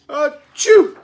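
loud, high storybook sneeze; acted sneeze. A narrator's reading. Reader acts the sneeze before the crash, explosive. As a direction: burst out with a big pretend sneeze.

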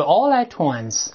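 slow, linked: "All at once" run together as "al-la-twans". Shown as a demonstration. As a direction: let "all at once" flow as one word, t carried to once. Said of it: "连读".

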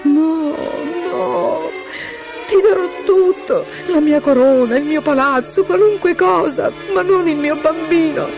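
very high, anguished pleading; supplica disperata. Acted. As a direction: beg frantically in a high voice. Regina supplica per il figlio. (supplica angosciata, voce acutissima)

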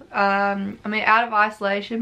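ordinary talk, nothing marked

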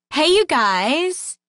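high, slow, perky greeting; perky young girl. A narrator's reading. Random girl greets the table, overly perky and slow. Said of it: "a little too perky for Sam's liking".

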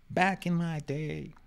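put-on high, drawn-out old-timer voice; old man. Speaker feeling relevant, reprising a put-on old man. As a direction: stretch it slowly like an old man. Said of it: "sounding like an old man".

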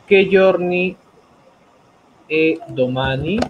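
loud, slow teacher's question, rising at the end; emphatic, stretched-out prompt with a pause midway; slow modelling. Shown as a demonstration. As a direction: project it in separated chunks for a learner.

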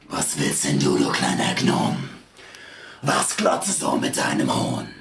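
extremely low, growling demon voice; menacing demon. A narrator's reading. Demon challenging the fearless little rider, deep menace. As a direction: rumble it down at a tiny challenger.

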